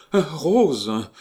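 high, loud exclamation; impetuous cry. A narrator's reading. Princess hearing of his sister, crying out impetuously. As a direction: burst out with the name. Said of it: "s'écria la fausse princesse d'Epstein impétueusement".

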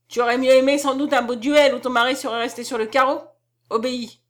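loud, hard reproach; harsh. A narrator's reading. Count taunting then ordering his wife, harsh. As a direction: press her harshly into obeying. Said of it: "répondit-il durement".

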